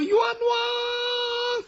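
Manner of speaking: very high, long-held cry of injustice; ghostly woman calling out her grievance; woman's wronged wail. Acted. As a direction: wail it high on one sustained note. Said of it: "往往出现是女生".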